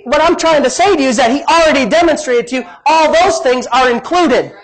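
ordinary talk, nothing marked

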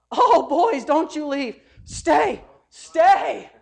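loud, high mock pleading; hammed-up plea for the boys to stay; begging. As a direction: call out in exaggerated desperation. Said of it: "he's begging them to stay".

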